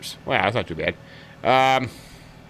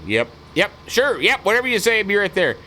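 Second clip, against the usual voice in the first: higher-pitched eager yeses; helplessly agreeable; 8 semitones higher than usual. Acted. Unable to refuse Adrian on the phone, acted eager agreement. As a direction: play someone caving in on the phone. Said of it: "even if you're trying to say no".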